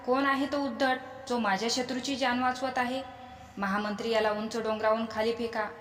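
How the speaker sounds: usual speaking voice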